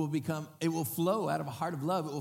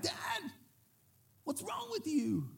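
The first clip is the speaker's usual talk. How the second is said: mocking shout at dad; 13 semitones higher, slower than usual. Voicing someone mocking crying brother.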